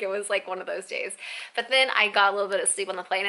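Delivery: usual speaking voice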